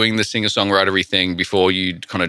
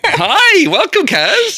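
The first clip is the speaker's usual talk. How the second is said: much higher, louder laughing welcome; excited greeting; 5 dB louder, much slower than usual. Real, not acted. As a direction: greet a friend with a burst of joy. Greets the guest with delight.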